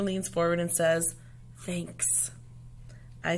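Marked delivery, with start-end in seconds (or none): 1.6-2.3 soft voiceless whisper; whispered thanks. Acted. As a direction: lean in and whisper it. Antonio leans forward in line.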